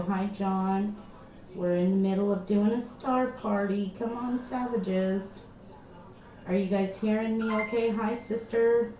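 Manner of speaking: ordinary talk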